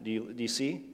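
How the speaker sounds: ordinary talk, nothing marked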